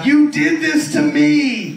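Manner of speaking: high, slow mock accusation; wife in labour blaming husband, high and slow; screaming wife in labour. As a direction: drag it out like a wife mid-birth. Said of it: "as a husband and goes".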